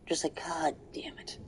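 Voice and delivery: a whispered God damn it, no voice at all; whispered curse. Real, not acted. Gamer whispers the curse at the broken game, defeated. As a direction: hiss it under your breath, exasperated. Repeats the curse under breath.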